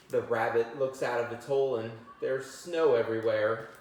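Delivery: plain reading voice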